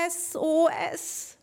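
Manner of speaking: high, slow squeaky SOS; slow desperate call. Acted. Trapped mole slowly squeaking out its distress call. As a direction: spell it out like a tiny distress signal.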